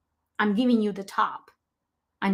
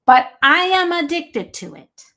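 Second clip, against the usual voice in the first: real upset, higher and louder; 6 semitones higher, 6 dB louder than usual. Real, not acted. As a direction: insist with personal distress, raising the voice. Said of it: "I get kinda upset about this".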